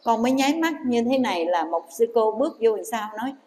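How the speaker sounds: plain reading voice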